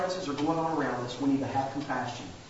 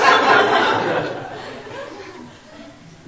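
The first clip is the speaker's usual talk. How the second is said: loud breathy laugh; 10 dB louder than usual. Real, not acted. Laughs at himself.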